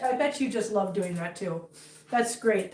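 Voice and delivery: ordinary talk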